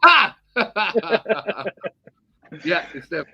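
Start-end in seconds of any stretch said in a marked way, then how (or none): 0.0-1.9 high, loud "Ah!" into laughing; loud burst of laughter. Real, not acted. Co-host reacting to host's polite thanks, bursting into laughter.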